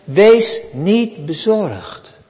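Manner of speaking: very slow, weighty "Wees niet bezorgd."; solemn words of Jesus; slow solemn command. Shown as a demonstration. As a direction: lay each word of "Wees niet bezorgd." down slowly, gravely. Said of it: "Maar Jezus zegt".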